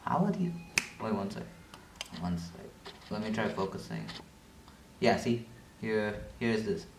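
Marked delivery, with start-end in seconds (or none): 1.0-4.1 softly, off to the side; murmured aside to herself; quiet aside. Real, not acted. Talking off-mic quietly.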